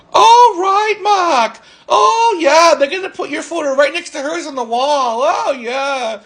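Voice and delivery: very high-pitched mocking imitation; sarcastic retort. Acting out coworker's sarcastic reply.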